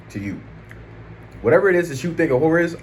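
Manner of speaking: normal talking voice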